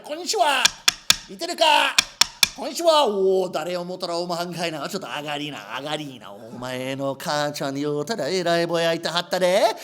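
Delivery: rakugo in Osaka dialect. Acted. Rakugo performer playing a neighbour and an old woman in a Kansai scene. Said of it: "performed my first story".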